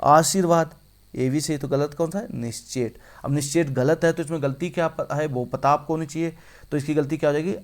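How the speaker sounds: usual speaking voice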